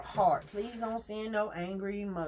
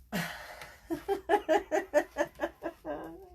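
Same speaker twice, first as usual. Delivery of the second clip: a high, rising burst of laughter; 8 semitones higher than usual. Real, not acted. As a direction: laugh it off, going up in pitch. Laughs at viewer's debit card.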